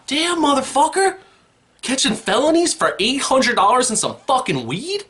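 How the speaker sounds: hammed-up high-pitched outrage; outraged squeal. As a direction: screech your disbelief at the news. Outraged rant at news story.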